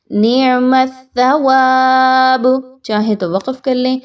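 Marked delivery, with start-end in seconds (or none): 0.1-2.5 slow melodic chant; Quranic recitation. Shown as a demonstration. Teacher reciting the verse ending, melodic chant. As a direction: chant it slowly and evenly.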